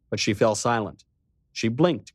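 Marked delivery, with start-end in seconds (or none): none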